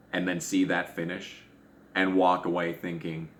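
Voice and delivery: usual speaking voice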